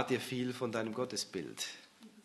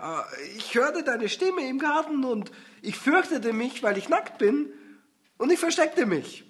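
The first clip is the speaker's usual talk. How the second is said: frightened Adam; 12 semitones higher, 6 dB louder than usual. Acted. Adam answers God in fear.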